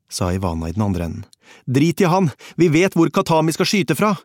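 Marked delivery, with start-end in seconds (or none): 1.7-4.1 high, loud, impatient outburst; urgent shouting. A narrator's reading. Narrator cuts Ivana off about Khatami, urgent shout. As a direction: cut across the caller, shouting high.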